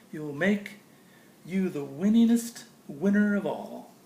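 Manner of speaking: bright, lilting storybook read; sing-song picture book reading; reading to children. Acted. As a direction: read it like bedtime verse for a child. Reads Dr. Seuss to kids.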